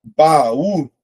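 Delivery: BAÚ slow and loud, ba-ú; syllable by syllable. Shown as a demonstration. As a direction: separate BAÚ into two drawn-out syllables. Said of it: "Vou separar".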